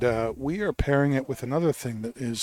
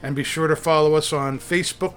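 podcast intro read; 2 semitones higher than usual. Acted.

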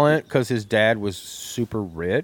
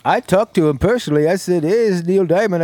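higher, louder showman voice; [name] character; 5 semitones higher, 4 dB louder, faster than usual. Pretend. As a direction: play a boastful [name].

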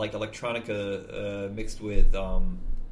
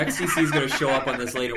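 laughing; 6 dB louder, faster than usual. Real, not acted. Teasing after fight words.

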